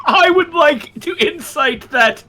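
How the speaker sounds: high, laughing through the words. Real, not acted. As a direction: giggle out the joke. Laughing at the pun.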